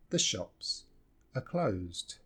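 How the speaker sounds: "are" in "the shops are closed" as a weak uh; low, soft phrase with "are" barely stressed; reduced "are". Shown as a demonstration. Teacher repeating the reduced form, weak "are". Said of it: "we just reduce this".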